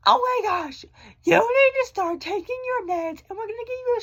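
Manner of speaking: high squeaky shocked doctor voice; mock the doctor's shocked squeal; astonished doctor. Vlogger imitating her doctor's astonished high-voiced reaction. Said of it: "she's like".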